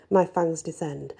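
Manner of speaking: usual speaking voice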